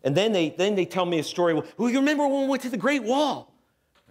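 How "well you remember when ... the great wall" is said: put-on high, eager kid voice; pitched way up, childlike and keen; excited child. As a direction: ask it like an excited little kid. Kids' excited question retold.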